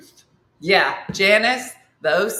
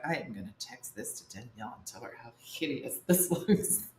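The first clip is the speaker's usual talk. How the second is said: catty; 8 semitones lower, 11 dB quieter than usual. Retold or imitated. Imagined snide text plan.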